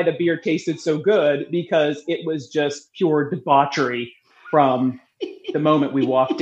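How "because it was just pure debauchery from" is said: ordinary delivery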